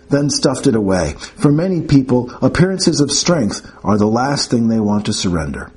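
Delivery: ordinary delivery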